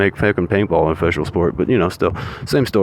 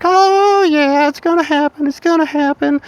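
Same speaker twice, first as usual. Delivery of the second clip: eager troops. Retold or imitated. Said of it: "everybody's like".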